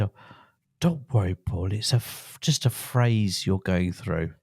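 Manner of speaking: put-on grandmother's Stoke accent, pitched right down. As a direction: imitate a Stoke grandmother reassuring a child, low and unhurried. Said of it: "She had a Stoke accent".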